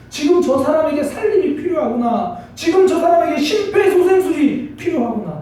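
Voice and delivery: high, loud, emphatic realization; urgent cry. Acted. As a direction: cry it out like an emergency. Urgent realization that someone needs rescuing.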